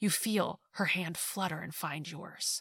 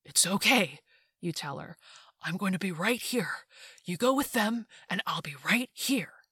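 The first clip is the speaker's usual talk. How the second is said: babbling. A narrator's reading. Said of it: "You know you're babbling".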